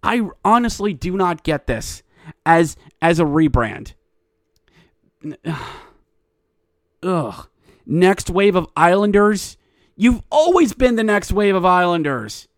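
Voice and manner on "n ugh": exasperated sigh. Real, not acted. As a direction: groan softly in exasperation. Exasperated sigh during rant.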